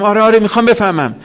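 high, fast and eager; quick excited yes yes. Acted. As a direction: blurt it out, keen to know. Eager reply in acted dialogue.

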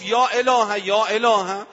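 high, loud repeated invocation; calling out to God. Retold or imitated. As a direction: cry out to heaven again and again.

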